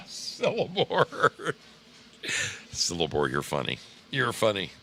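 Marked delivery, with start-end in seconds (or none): 0.1-1.3 laughing. Real, not acted. Laughing at viewer's joke.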